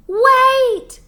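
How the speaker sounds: high, loud desperate cry. A narrator's reading. Sheep crying after the tractor, high and loud. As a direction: call out so they stop. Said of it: "cried Sheep".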